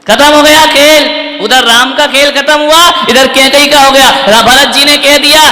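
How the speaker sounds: preaching at full force. Real, not acted. Preacher pressing Kaikeyi's scheme on the audience, full force. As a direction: drive the point home to the crowd.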